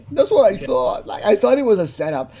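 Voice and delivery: loud, high-pitched mocking quote; hammed-up imitation of his protest; mock excuse. Imitating what he says when caught, loud high mock voice.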